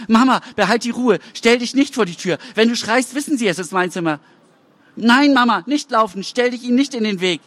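put-on high, urgent, frightened boy's voice; hiding teenage son begging his mother to stay calm. A narrator's reading. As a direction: warn mama in hushed panic. Hidden boy warns mother.

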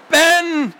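high, loud tearful scream of a name. Acted. Anna screaming after her brother, high scream. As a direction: scream for him, near tears. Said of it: "schrie Anna".

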